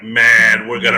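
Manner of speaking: loud, pumped-up fan call; excited hype. Retold or imitated. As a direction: shout it like the win is certain. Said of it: "And I'm like".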